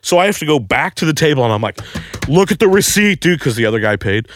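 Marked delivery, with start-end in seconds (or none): 2.3-3.4 high-pitched frantic demand; urgent plea. Retold or imitated. Desperate man to his friend at the table, urgent high plea. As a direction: beg urgently, voice pitched right up.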